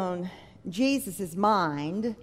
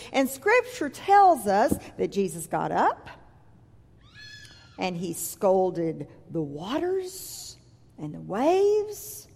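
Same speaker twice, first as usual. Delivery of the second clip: ordinary talk, nothing marked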